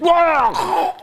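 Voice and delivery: a sudden shrill-pitched startled yelp; screamed wah. Retold or imitated. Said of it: "he screamed".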